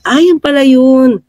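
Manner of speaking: ordinary delivery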